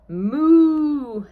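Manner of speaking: high, hammed-up moo; cow moo. A narrator's reading. Cow sound in story.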